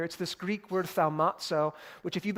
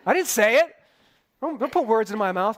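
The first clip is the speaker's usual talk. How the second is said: mock indignant, getting louder by "bro"; a bro protesting, half joking; hammed-up Pilate, louder and fed up; pretend exasperated Pilate; 4 semitones higher, 6 dB louder than usual. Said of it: "If I was Pilate, I'd be like".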